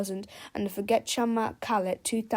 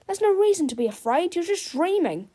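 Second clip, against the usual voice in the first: put-on boy's voice; 8 semitones higher, 5 dB louder than usual. A narrator's reading. George reassuring the teacher.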